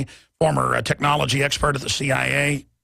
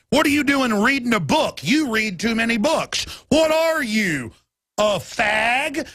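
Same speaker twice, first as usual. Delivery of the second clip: higher, taunting mock sneer; mock jeering bully; 6 semitones higher, slower than usual. Mocking people who sneer at readers, higher and taunting. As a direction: jeer like a schoolyard bully. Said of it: "I've seen it".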